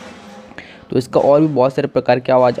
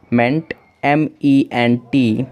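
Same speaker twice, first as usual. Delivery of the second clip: each letter M E N T spaced out slowly; slow spelling out; much slower than usual. Shown as a demonstration. Teacher slowly spelling out meant as M E N T. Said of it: "ये common word है".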